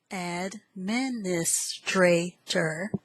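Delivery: "administrator" slowly parted as ad-min-is-tra-tor; syllable by syllable. Shown as a demonstration. Teacher introduces word eight, "administrator" in slow syllables. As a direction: say "administrator" slowly in five separate beats.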